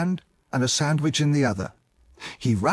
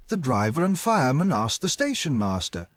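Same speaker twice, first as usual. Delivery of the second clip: plain reading voice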